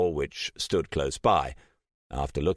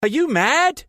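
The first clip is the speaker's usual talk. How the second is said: much higher, louder shocked scream; 9 dB louder than usual. A narrator's reading. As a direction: shriek it at her in disbelief. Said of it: "Trish screamed at her".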